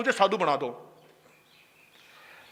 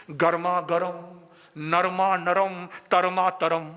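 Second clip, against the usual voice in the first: slow savouring; 5 semitones lower, slower than usual. Acted. Savouring description of the khichdi.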